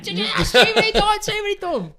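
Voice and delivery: high, loud outraged appeal through laughter; screaming at the ref. Retold or imitated. The cheeky player screaming at the ref, loud and high. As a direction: wail at the referee like a wronged player. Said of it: "screaming at the ref".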